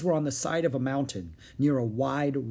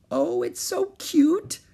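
high, slow cooing; sugary high-pitched delight; gushing adoration; 4 dB louder, slower than usual. A narrator's reading. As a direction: coo over a tiny animal. Annie gushes over the mouse.

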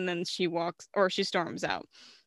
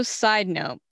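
usual speaking voice

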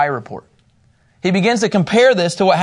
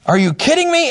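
much higher disbelieving outburst; incredulous; 11 semitones higher than usual. Retold or imitated. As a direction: Moses can't believe it. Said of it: "he's like".

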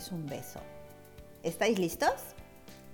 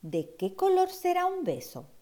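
high wondering question; 10 semitones higher than usual. Acted. Child wondering aloud.

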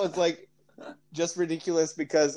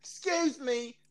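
put-on high, softer Australian-accented interjection; 9 semitones higher, 8 dB quieter, slower than usual. Replying to talk of offending a nation, Australian accent. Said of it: "I'm gonna do an Australian accent".

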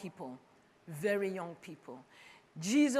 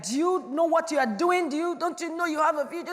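condescending scolding; 8 semitones higher, 11 dB louder than usual. Retold or imitated. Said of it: "look down on them like".

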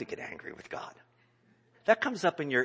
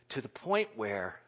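normal talking voice

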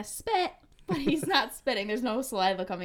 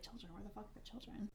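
quiet; 19 dB quieter than usual. Retold or imitated. Retold confused reply.

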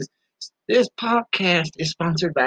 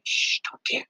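whisper; 11 semitones higher, 5 dB quieter than usual. Acted. Secret kept from Mama Bear.

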